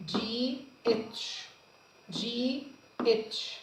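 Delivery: جي اتش spelt out slowly; name the letters جي اتش for the class; slow spelling. Shown as a demonstration. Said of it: "teacher spells letters slowly".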